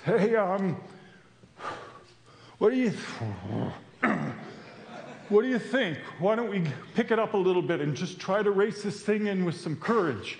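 lightly voiced fellow runner, hesitant then urging; a hint of a worn-out runner cheering others on; rallying runner. Fellow runner rallies the group.

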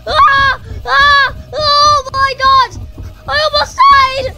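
high, very loud panicked screaming. Real, not acted. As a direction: scream breathlessly through a frantic chase. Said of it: "I'm sorry if I'm screaming too loud".

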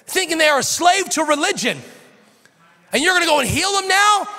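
high, loud, outraged protest; mock-outraged religious leader shouting; indignant leader. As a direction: scold like a threatened gatekeeper.

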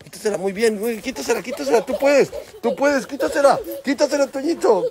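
high, singsong cheering; ánimo entusiasta. Real, not acted. Anima al niño jugando fútbol. (ánimo agudo y entusiasta)

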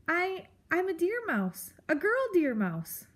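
high, hesitant little voice; timid mouse. Acted. Mouse tells the grump what she is, timidly. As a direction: stammer it meekly in a high pitch.

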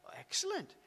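pitched high, eager questions; eager rescuer. Retold or imitated. Rescuer quizzing the castaway about buildings, high and eager. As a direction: ask it like an impressed visitor.

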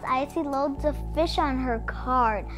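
ordinary delivery, nothing marked

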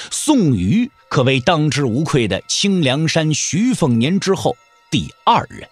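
usual speaking voice

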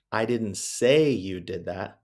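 slow read with weight on say; stress on SAY. Shown as a demonstration. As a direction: say punched, slow and deliberate. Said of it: "but said four different ways".

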